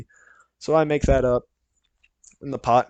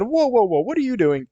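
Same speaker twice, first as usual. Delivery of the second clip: startled whoa; 9 semitones higher than usual. Retold or imitated. Son catching dad taking his door off, alarmed. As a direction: shout in alarm at the sight.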